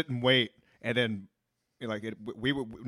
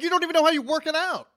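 high, fast, scoffing quote; mocking; 13 semitones higher, 4 dB louder, faster than usual. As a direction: sneer at the gym posers, pitched high. Mocking gym posters.

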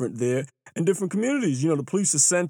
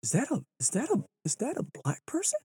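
gawking onlooker; 7 semitones higher, 7 dB quieter than usual. Retold or imitated. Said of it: "when people are like".

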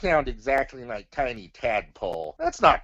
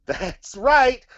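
excited; 10 semitones higher, 7 dB louder than usual. Acted. Puppet cheer.

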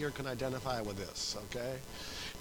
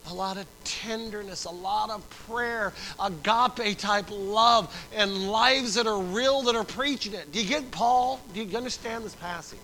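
raised, insistent pulpit delivery; fervent preaching; 7 semitones higher, 7 dB louder than usual. Real, not acted. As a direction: build force, stretching the key words. Preaching at full force.

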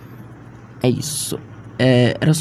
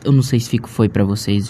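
ordinary talk, nothing marked

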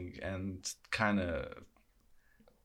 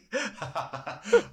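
high laugh; 12 semitones higher, 5 dB louder than usual. Real, not acted. Laughing at the bit.

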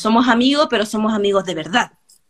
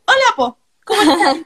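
put-on much higher, bright Chilean-accented hello from a German friend; 8 semitones higher, 4 dB louder than usual. German friend greeting the hairdresser, imitated in a high Chilean accent. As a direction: greet like a German who picked up Chilean Spanish. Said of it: "Y con el acento chileno".

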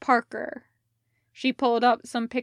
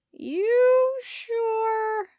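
doubtful delivery guy; 12 semitones higher, 4 dB louder than usual. Acted.

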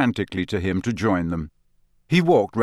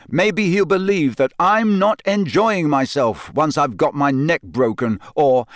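angry; 6 semitones higher, 4 dB louder than usual. Acted. Harry continues angrily, loud.

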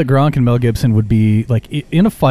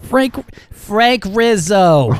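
high, slow goofy voice; lightly put-on silly voice; mock voice; 8 semitones higher, much slower than usual. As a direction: ham up the wrong name. Joking mishearing of name.

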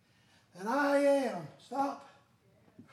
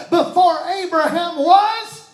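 slow, rising proclamation; Jesus answering the Pharisees, proclaiming; Jesus declaring; 4 semitones higher, 9 dB louder than usual. Acted. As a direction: declare it with weight. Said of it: "what Jesus tells them".

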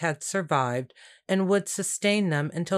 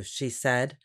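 ordinary delivery, nothing marked

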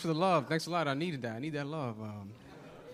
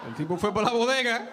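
imitating dad retelling it, pitch raised; insistent Spanish, capped with one accented English word; dad's Spanish accent; 5 semitones higher than usual. Dad repeating his statement to the cop, Spanish accent. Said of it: "He's like".

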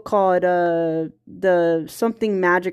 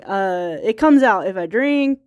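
usual speaking voice

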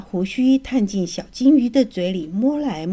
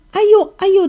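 high, louder pained little cries; crying out "哎呦"; 11 semitones higher, 7 dB louder than usual. A narrator's reading. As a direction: moan out in pain twice. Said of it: "的叫声". (小金鱼牙痛连声叫唤)

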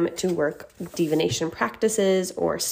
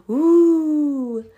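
howling like a wolf; 8 semitones higher, 4 dB louder than usual. Pretend. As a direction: pretend wolf howl, high and drawn out. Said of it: "that was my pretend wolf noise".

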